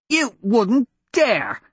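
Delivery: slow, high, indignant cry; outraged prince. Acted. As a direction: royal disbelief, stretched out.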